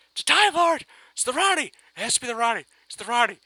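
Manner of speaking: high, loud excited yells; gleeful fan realization; giddy shout. Retold or imitated. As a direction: shout it like a fan proved right.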